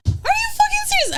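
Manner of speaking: disbelieving high-pitched outburst; outraged squeak. Retold or imitated. Outraged at guy, retold.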